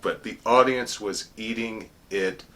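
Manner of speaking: ordinary talk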